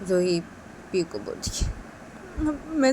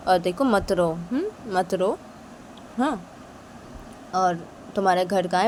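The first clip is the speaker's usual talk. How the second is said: ordinary delivery, nothing marked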